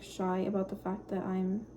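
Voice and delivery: ordinary talk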